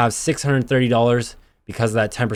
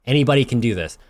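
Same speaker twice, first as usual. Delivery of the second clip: normal talking voice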